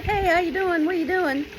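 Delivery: put-on very high, sing-song child voice; cheerful kid greeting. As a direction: call out brightly to a friend. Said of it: "it's like".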